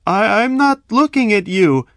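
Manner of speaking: high, loud stammered denial; frightened lie. A narrator's reading. Victim lying to robbers, high scared stammer. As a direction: stammer it out in fear. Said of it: "I lied".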